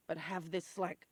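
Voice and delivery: ordinary talk, nothing marked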